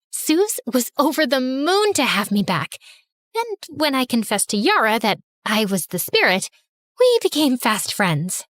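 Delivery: ordinary delivery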